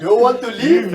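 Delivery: loud, high mock African accent, laughing. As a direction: put on an African accent, amused. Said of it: "It's more African".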